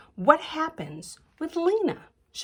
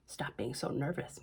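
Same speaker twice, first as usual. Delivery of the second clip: hushed; 11 semitones lower, 13 dB quieter than usual. Pretend. Imagined dress talking softly.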